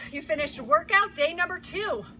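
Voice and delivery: ordinary talk, nothing marked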